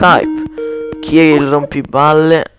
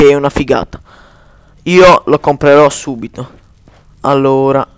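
ordinary talk, nothing marked

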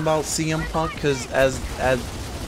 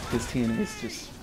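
muttered frustration; 5 semitones lower, 11 dB quieter, faster than usual. Real, not acted. Frustrated aside, trailing off.

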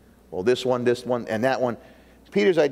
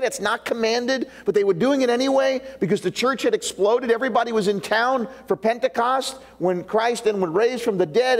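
ordinary delivery

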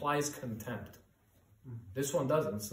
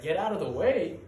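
louder urgent warning shout; retold yell; 5 semitones higher, 8 dB louder than usual. Father yelling at his child in danger, loud warning. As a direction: warn the child to move. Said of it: "The father who would yell at their child".

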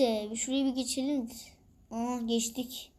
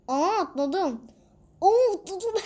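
much higher, louder, laughing cry; excited laughing; 6 semitones higher, 4 dB louder, slower than usual. Real, not acted. Gamer thrilled at a jump, high loud laughing cry. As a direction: burst out laughing as you jump.

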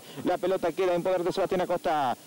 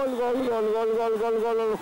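rapid-fire goal shouts; breakneck goal commentary. Real, not acted. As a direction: rattle off the goal call. Goal celebration call.

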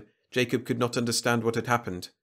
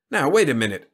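raised, louder protest; a firm, higher-pitched interruption; objecting; 4 semitones higher, 7 dB louder than usual. A narrator's reading. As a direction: cut in with an objection. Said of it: "objected Jacob".

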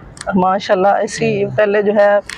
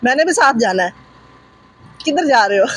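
put-on 1 playful baby talk; 6 semitones higher than usual.

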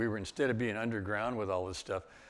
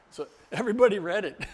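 excited punchline; 11 semitones higher, 10 dB louder, faster than usual. Real, not acted. Punchline of story.

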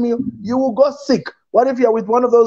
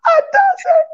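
excited cry. Retold or imitated. Shows what normal love sounds like.

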